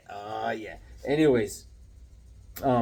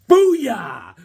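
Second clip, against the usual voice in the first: higher, louder booyah; triumphant cheer; 7 semitones higher, 6 dB louder than usual. Real, not acted. Collector cheering his Marketplace find, loud and high. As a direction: shout it like a win.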